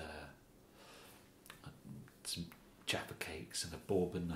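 a kindly officer softly offering tea; soothing police officer. Acted. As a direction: coax the suspect along kindly. Police officer coaxes the suspect.

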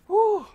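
high, loud "whew"; relieved whoop. Real, not acted. Player recovering after a scare, loud relieved whew.

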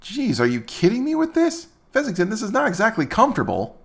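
ordinary talk, nothing marked

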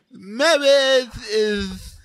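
high, hugely stretched lisping drone; hammed-up Princess Bride wedding officiant; lisping movie clergyman. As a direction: intone it very slowly with mangled consonants. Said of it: "sound like the guy in, uh, uh, Princess Bride".

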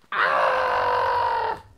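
deep, loud raptor screech; raptor scream. Pretend. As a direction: belt out a raptor shriek. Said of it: "let me just do my raptor scream".

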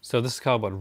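usual speaking voice

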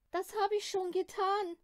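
high, tearful reply; crying nanny. Acted. Nanny tearfully says she already searched town. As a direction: answer through tears. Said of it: "sitzt und weint".

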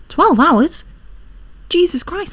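loud high disbelief; shocked. Real, not acted. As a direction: shout it in disbelief.

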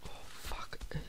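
hushed frightened whisper; whispered curse. Real, not acted. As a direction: breathe it out in a whisper. Scared in dark game.

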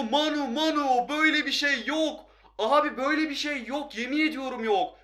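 high, loud cheers with drawn-out name calls; real elated shouting, pitched well up; excited goal celebration. Real, not acted. Goal celebration during live play.

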